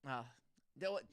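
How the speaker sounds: ordinary delivery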